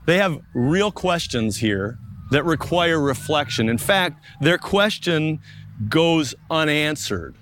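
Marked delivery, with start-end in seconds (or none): none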